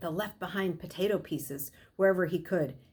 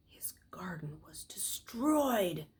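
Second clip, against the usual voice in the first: slow, breathless whisper; stunned whisper; 5 dB quieter, slower than usual. A narrator's reading. Narrator reveals the ruined garden, hushed and stunned. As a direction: let the shock steal the voice.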